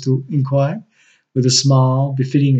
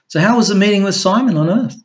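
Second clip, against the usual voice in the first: put-on lightly raised, lively young woman; Crystal's voice; 7 semitones higher, 4 dB louder, faster than usual. A narrator's reading. Narrator voicing Crystal asking Rex about his meeting, lightly higher. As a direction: voice Crystal a little higher and brighter.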